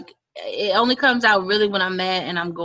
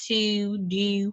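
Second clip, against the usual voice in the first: Baltimore accent; 5 dB quieter than usual. Shown as a demonstration. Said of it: "when they say certain words".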